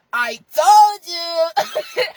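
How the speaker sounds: TOLD YOU stretched long, high and loud; drawn-out gloating. Retold or imitated. Rubbing it in after coming back, drawn-out gloating. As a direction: crow it slowly, rubbing it in.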